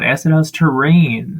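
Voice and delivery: ordinary delivery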